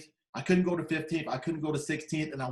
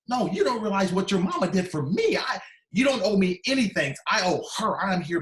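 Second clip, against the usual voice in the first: raised, louder, insistent retold objection; emphatic protest; 3 semitones higher, 5 dB louder than usual. Visitor refusing thanks from the daughter, emphatic protest. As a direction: push back hard, pitched up.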